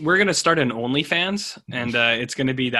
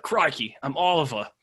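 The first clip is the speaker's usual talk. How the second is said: light mock New Zealand accent; a playful touch of New Zealand accent; imitated New Zealand accent; 3 dB quieter than usual. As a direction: imitate a New Zealand listener introducing himself. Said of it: "that's his accent. He's like".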